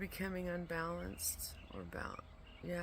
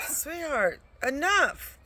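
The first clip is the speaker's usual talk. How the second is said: much higher and louder, sharp yell; shouting the pet down after a calm warning failed; real shout at pet; 10 semitones higher, 12 dB louder, slower than usual. Real, not acted. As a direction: bark it so she stops now. Yelling at her pet to stop.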